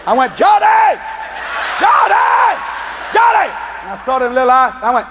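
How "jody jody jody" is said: high, exasperated-dad style holler; calling out. Retold or imitated. Dad walks out of his house hollering for his daughter. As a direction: holler the name up high, stretched at the end.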